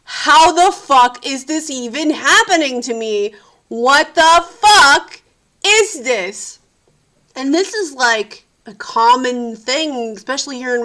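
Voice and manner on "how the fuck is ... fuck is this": slow, loud, stretched what the fuck; disbelieving anger. Retold or imitated. Stuck on set over time, loud slow disbelieving anger. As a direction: drag out the outrage. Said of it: "I'm just like".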